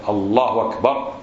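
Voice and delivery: usual speaking voice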